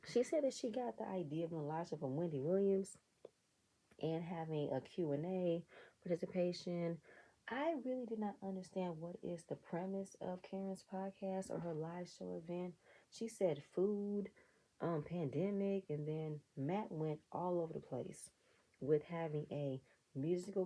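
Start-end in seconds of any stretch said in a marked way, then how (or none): none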